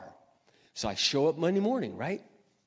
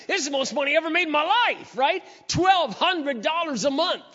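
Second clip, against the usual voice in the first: thrilled; 14 semitones higher, 8 dB louder than usual. Retold or imitated. A young man bragging to the audience about his first salary, thrilled and loud. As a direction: crow about the big money.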